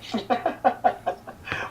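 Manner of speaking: a quick, high, breathy laugh of surprise. Real, not acted. Guest caught off guard by accent question, laughing.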